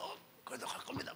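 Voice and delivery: strangled, barely voiced reply; imitated choking. Choked private insisting on church to his senior, strangled whisper. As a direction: squeeze the words out as if being throttled. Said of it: "목을 막 꽉 막 졸르면서".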